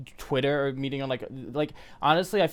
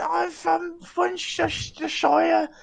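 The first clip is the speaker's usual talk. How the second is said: high, drawn-out mock British accent; 13 semitones higher, much slower than usual. Teasing a British guest, exaggerated British accent. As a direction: stretch it into a hammed-up British voice. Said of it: "I'll do my British accent".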